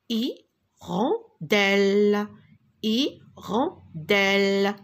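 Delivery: "Hirondelle" slowly split into hi-ron-delle; syllables clapped. Shown as a demonstration. Teacher showing pupils three syllables, slowly split word. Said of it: "trois syllabes".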